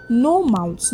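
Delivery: ordinary delivery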